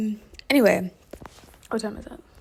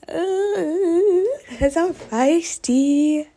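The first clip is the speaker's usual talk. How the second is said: slow, high sassy drawl; 7 semitones higher, 4 dB louder, much slower than usual. Acted. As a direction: flaunt it proudly. Mock-proud self declaration.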